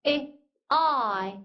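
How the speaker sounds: slow "에" then "I" said as "아이"; vowel demo. Shown as a demonstration. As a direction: model "에" changing to "I", slowly. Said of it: "I는요". (에에서 아이로 천천히)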